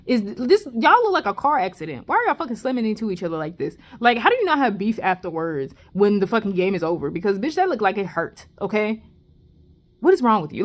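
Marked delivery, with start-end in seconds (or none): none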